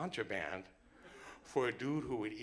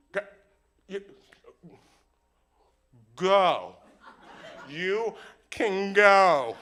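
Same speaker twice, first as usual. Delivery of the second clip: loud, high, halting cop voice; stammer, then shout it slowly as a flustered cop; panicking cop; 8 semitones higher, 14 dB louder, much slower than usual. Acted. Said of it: "the cop starts panicking".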